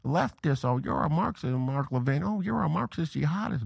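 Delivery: mocking critics. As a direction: sneer at the accusers in a lightly mocking tone. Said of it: "all those out there who say".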